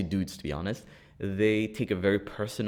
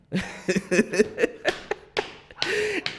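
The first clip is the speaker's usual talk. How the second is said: high laugh; 3 dB louder than usual. Real, not acted. Laughing at own story.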